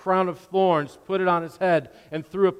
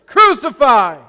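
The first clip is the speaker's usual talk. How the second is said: much higher and louder, slow and drawn out; furious mob shout; 10 semitones higher, 15 dB louder, slower than usual. Acted. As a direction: roar it like an angry crowd. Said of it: "they shouted".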